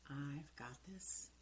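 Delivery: hushed, slow, self-soothing; slow whispered reassurance. Shown as a demonstration. Coach demonstrating calming self-talk, slow whisper. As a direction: whisper it slowly. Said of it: "and you say".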